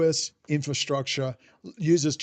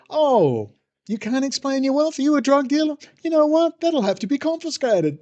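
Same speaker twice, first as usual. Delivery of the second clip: high-pitched, loud mock astonishment; hammed-up official pouncing on a confession; mock-shocked official; 11 semitones higher, 5 dB louder than usual. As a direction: put on a high voice, feigning shock at a suspect. Acted official catching a drug dealer.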